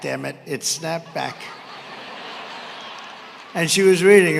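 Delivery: usual speaking voice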